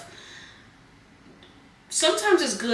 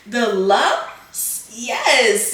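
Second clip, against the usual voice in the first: failed squeaky voice; 3 semitones higher, 8 dB louder, much slower than usual. Pretend. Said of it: "do my squeaky voice".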